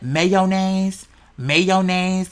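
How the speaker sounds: softly drawn-out, careful "mayonnaise"; proper mayonnaise. Retold or imitated. Mocking an ugly word, over-proper "mayonnaise". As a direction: say "mayonnaise" in full as may-o-naze. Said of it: "When you hear people say it, like, proper".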